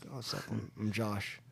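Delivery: very soft and low, stumbling on the name; subdued, awkward reply. Retold or imitated. Newcomer introduces himself to old hippie, subdued and awkward. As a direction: shrink into a quiet, awkward introduction.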